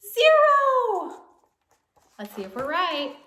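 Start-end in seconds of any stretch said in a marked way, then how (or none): 0.0-1.2 high loud zero; excited answer. Acted. Teacher answering the count for kids, excited. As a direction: call it out, thrilled.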